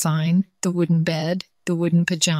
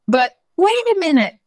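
very high, loud burst of surprise; startled cartoon squeal of discovery; sudden realisation; 12 semitones higher, 6 dB louder than usual. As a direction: exclaim as it suddenly dawns on you. Tiger notices hiccups have gone.

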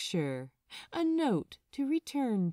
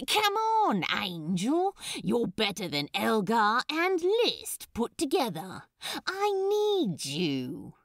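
louder, playful persuasion, slowing on the last plea; coaxing demon; 4 dB louder than usual. Acted. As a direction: charm your friend on stage. Crowley coaxing the angel to play.

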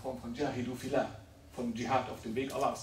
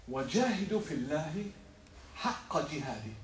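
Koranrezitation; much faster than usual. Acted. Koranvers in der Predigt rezitiert.

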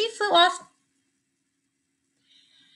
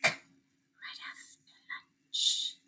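whisper; 13 dB quieter, much slower than usual. Real, not acted. Whispered aside.

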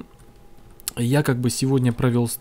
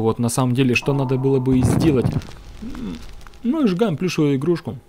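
usual speaking voice

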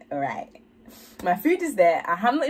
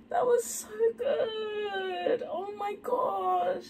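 choked-up, high and teary, close to crying; overwhelmed to tears watching the video; tearful and emotional; 11 semitones higher, 5 dB quieter, much slower than usual. Real, not acted. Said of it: "not me getting emotional".

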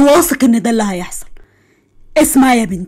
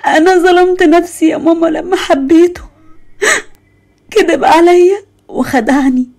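sobbing, pleading with her mother, pitch raised high; crying to mom; 6 semitones higher than usual. Acted. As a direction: break down in tears calling for mama. (بكاء وانهيار وهي تنادي أمها)

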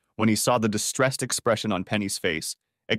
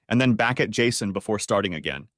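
ordinary delivery, nothing marked

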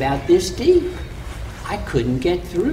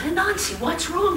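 concerned neighbors; 13 semitones higher, 4 dB quieter than usual. Acted. Neighbors asking, high voices.

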